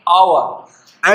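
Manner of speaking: आवर drawn out very slowly, starting straight on the vowel with no h; slow silent-h demo. Shown as a demonstration. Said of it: "पहला अक्षर एच है, लेकिन ये silent है".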